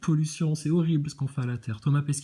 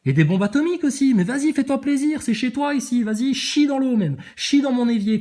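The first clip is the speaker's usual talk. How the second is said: higher and louder, mocking invitation to go ahead; sarcastic outburst; 8 semitones higher, 4 dB louder than usual. Real, not acted. Ranting at a chat viewer, sarcastic and raised. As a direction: egg them on with biting sarcasm.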